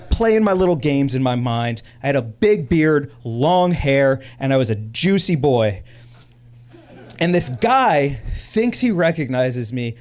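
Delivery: ordinary talk, nothing marked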